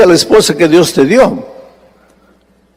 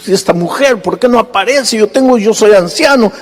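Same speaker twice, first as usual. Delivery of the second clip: acted-out agitated elder, pitched well up; imitated nervous impatience; 7 semitones higher than usual. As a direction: fret in a higher voice, as if running late. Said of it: "el anciano estaba nervioso".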